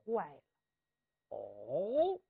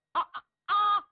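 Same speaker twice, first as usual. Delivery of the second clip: high, loud startled cries; frightened cries; 8 semitones higher, 6 dB louder than usual. Acted. Servants cry out when the strategist questions them, terrified. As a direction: gasp out in terror.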